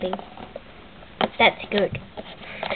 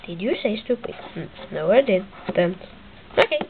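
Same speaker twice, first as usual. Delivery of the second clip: ordinary talk, nothing marked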